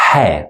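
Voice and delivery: he breathed from the middle throat; breathy throaty h; throat sound. Shown as a demonstration. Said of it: "throat sounds".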